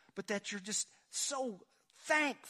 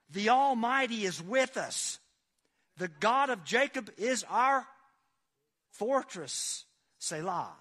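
loud, much higher, slow-swelling proclamation; preaching at full force; 5 semitones higher, 9 dB louder, slower than usual. Acted. Pastor proclaiming the psalm to the congregation at full force. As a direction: thunder the verse out over the pews.

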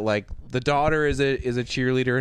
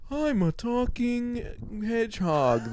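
slow, high cartoon critter voice; drawn-out high animal voice; talking hedgehog; 7 semitones higher, 4 dB quieter, slower than usual. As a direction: squeak it out like a kid's-movie hedgehog. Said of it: "[name] is like".

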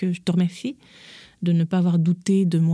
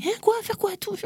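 high-pitched, incredulous mother's reaction; mock disbelief, pitched way up; startled mother; 4 dB quieter than usual. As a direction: imitate a stunned mum in a high voice. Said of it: "j'ai dit à ma mère".

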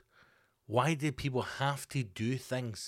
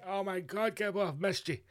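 higher, lightly gushing self-greeting; mock gushing; 8 semitones higher than usual. Host welcoming himself back, with lightly mock gushing. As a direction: gush over himself in a hint of a camp voice.

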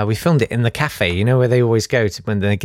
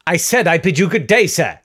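higher-pitched, huffy repeat; indignant dismissal; 7 semitones higher than usual. Acted. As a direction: insist on taking your leave. Repeats his dismissal more firmly.

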